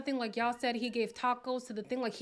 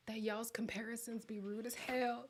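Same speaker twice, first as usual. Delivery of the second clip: whispered aside; 8 dB quieter than usual. Real, not acted. Aside while reading chat.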